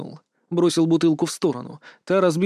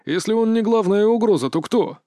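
higher, cocky soldier voice, pushing ahead; swaggering reckless fighter; brash tough guy Mark; 6 semitones higher than usual. A narrator's reading. Mark's voice.

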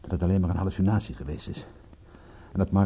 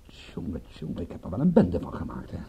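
low, half-whispered dismay; hushed and regretful; rueful self-reproach; 3 semitones lower, 5 dB quieter than usual. Acted. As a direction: mutter it to yourself, ruefully. Rueful after the kidnapper's call.

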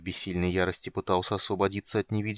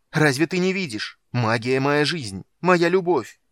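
loud, higher, desperate plea; desperate cry; 6 semitones higher, 8 dB louder than usual. Acted. Son desperately pleads with his father, loud. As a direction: pour out his love of magic. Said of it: "Голос Полины стал отчаянным".

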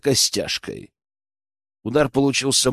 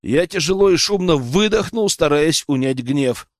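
usual speaking voice

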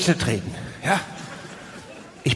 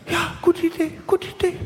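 much higher, giddy quick agreement; much higher, overeager date agreeing; eager squeaky yes; 12 semitones higher than usual. Acted. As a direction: squeak it out like a man who can't believe his luck. Man eagerly agrees to her place.

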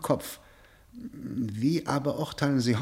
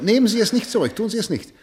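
urgent plea; 11 semitones higher, 8 dB louder than usual. Acted. Prosecutor urges prisoner to confess.

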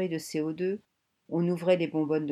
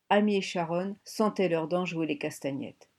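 ordinary delivery

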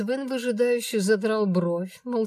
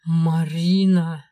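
stern warning; 8 dB louder, much slower than usual. A narrator's reading. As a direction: bark her name as a warning. Dragon warns her sternly. (грозно, медленно, громко)

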